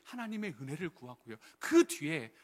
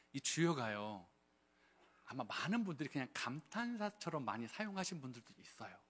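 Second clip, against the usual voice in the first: ordinary delivery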